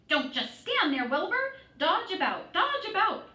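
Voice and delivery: high, loud goose commands; crying out. A narrator's reading. Goose commanding Wilbur to dodge, high loud cry. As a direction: bark orders at the pig, way up high. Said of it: "cried the goose".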